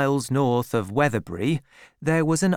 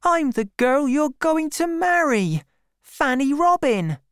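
put-on high, pleading girl's voice; hurt young woman, high-voiced; Fanny Robin; 4 dB louder than usual. A narrator's reading. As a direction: plead to be recognised, pitched way up. Fanny pleading with her lover.

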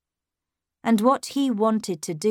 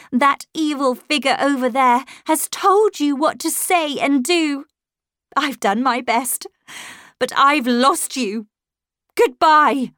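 raised, louder, distressed voice; anguished outburst; 6 semitones higher, 6 dB louder than usual. A narrator's reading. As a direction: burst out, ending on a loud farewell. Governess's bitter farewell to child.

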